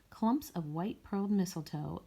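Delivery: usual speaking voice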